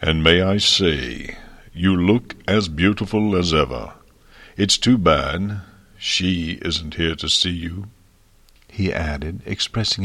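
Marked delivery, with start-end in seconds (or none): 0.0-7.9 deep thunderous dragon. A narrator's reading. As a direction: rumble it out like a giant dragon. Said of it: "his voice deep and thunderous".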